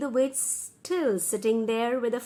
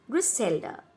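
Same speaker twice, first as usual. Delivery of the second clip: ordinary delivery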